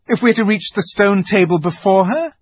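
high, loud question; urgent appeal. A narrator's reading. Peter argues for beating the witch, urgent. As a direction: push the argument home loudly. Peter urges leaving early.

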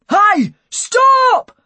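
high, loud shout to halt. A narrator's reading. Policeman chasing the fleeing boy, urgent shout. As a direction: yell after a runaway at full voice. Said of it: "I heard him shout behind me".

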